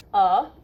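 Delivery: "Uh" as a clear, isolated vowel; sound demo. Shown as a demonstration. Teacher showing a student the letter's sound, isolated "Uh". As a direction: say "Uh" as the sound spelled U H. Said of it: "it's making the uh sound".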